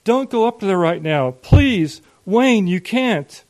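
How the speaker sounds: high, loud desperate plea; pleading yell. A narrator's reading. Mother begs father not to go upstairs, yelling. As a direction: yell it, begging him to stop. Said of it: "Mom yelled".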